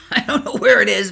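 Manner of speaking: laughing at her own cluelessness. Real, not acted. Laughs at herself.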